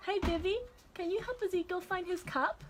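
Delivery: high, lilting mommy voice. Real, not acted. Mother directing her child to a cup, high parent voice. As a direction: pitch it right up for a little one.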